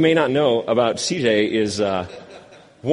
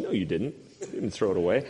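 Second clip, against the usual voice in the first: confused denial; 6 semitones lower, 8 dB quieter, faster than usual. Retold or imitated. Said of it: "a half statement, half question".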